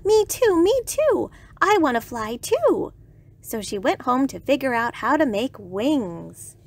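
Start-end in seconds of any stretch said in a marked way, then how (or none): 0.1-2.9 high, eager "me too" clamour; excited cry. A narrator's reading. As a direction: pipe up high, wanting it badly. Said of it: "cried Iguana".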